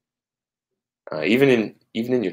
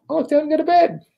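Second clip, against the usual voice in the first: excited. Retold or imitated. Retold delight at finding bed.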